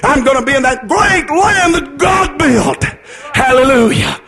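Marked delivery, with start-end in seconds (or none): none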